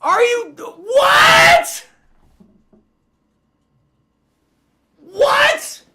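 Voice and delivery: high, loud cry of disbelief; anguished shriek. Real, not acted. Streamer watching Patriots score on a ricochet, anguished shriek. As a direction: wail it like the game just broke. Opponent touchdown on ricochet catch.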